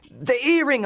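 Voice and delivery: urgent yell. Acted. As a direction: yell it to the man buried in the taxi. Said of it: "I yelled".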